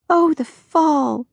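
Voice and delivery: high, slow munchkin voice; dreamy little munchkin sigh over autumn. A narrator's reading. Joyce gushes about autumn, slow high munchkin voice. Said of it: "her slightly munchkin voice".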